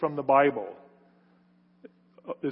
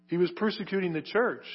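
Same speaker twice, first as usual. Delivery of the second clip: ordinary talk, nothing marked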